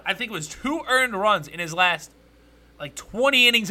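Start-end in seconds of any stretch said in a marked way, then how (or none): none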